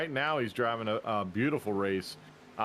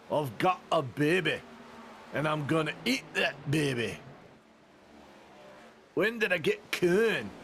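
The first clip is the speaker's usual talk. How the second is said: tough, heavy Scottish brogue, climbing higher; thick Scottish brogue; 4 semitones higher than usual. Shown as a demonstration. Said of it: "you gotta be super brogue, uh, Scottish".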